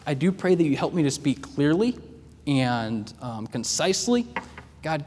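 soft, low prayer voice; hushed opening prayer. Acted. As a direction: pray it quietly and humbly. Prayer before the sermon.

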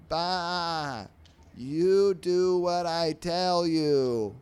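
high, loud, slow bleating order; bossy sheep. Acted. Sheep ordering the fly around, loud bleating boss.

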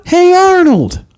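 high, drawn-out title announcement; announcer voice. Pretend. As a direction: call out the show name like a trailer. Announcing next show title.

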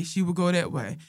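usual speaking voice

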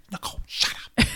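whispered, unvoiced hush. Hiding from a knock at the door, whispering. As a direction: hiss it to yourself so nobody hears. Hushing herself at the doorbell.

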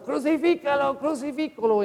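mock crowd cry, pitched high; crowd shouting. Priest voicing the crowd at the crucifixion, mock shout. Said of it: "Cuando ellos estaban gritando".

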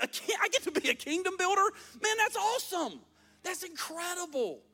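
high, excited disbelief; amazed delight. Acted. Preacher reacts to being called kingdom builder, high thrilled amazement. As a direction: marvel at an unexpected honour.

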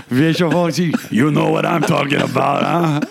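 thick mock French Canadian accent; put-on Quebec accent. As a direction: play a jovial Quebecker in heavily accented English. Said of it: "Quebec accent".

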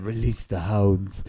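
low, slow villain's command; Mister Burns. Retold or imitated. Comedian quoting a cartoon villain over the dogs, slow villain voice. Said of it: "like, Who Shot Mister Burns".

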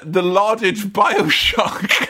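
ordinary talk, nothing marked

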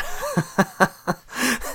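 squealing high laugh. Real, not acted. Laughing at own trip story.

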